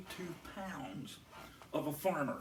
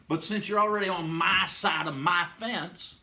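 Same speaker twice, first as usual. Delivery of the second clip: loud farmer; 9 dB louder than usual. Acted. Farmer threatening the hunter.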